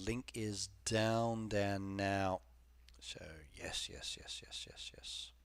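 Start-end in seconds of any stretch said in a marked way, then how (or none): 3.0-5.3 soft unvoiced whisper of yes. Real, not acted. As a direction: breathe the yeses out. Whispered yeses after link note.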